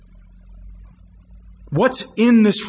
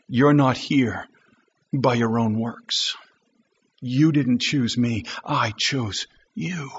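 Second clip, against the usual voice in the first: lower, soft and gentle; gracious, gentle; 9 semitones lower, 3 dB quieter than usual. Retold or imitated. Preacher voicing Jesus gently reassuring his disciples. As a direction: speak graciously, winding down to quiet.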